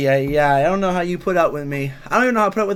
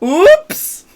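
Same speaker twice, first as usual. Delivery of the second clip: high, loud exaggerated oops; sliding up into a big mock oops; Ness's oops; 12 semitones higher, 9 dB louder than usual. Streamer mimics Ness's slip, exaggerated. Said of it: "Ness goes, "Oops".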